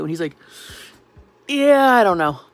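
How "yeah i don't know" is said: louder, higher, drawn-out answer; loud casual reply. Retold or imitated. Brother answering her call from a field, loud and casual. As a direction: imitate a brother hollering down the phone.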